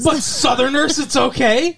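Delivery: high, loud sarcastic quip; high, loud mocking sarcasm; sarcastic jab. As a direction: needle the co-host with mock approval.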